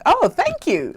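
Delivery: high, pleasantly taken-aback reply; surprised thanks. Retold or imitated. Thanking a stranger who called her beautiful, high surprised voice. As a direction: lift the voice right up on receiving a compliment. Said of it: "like that".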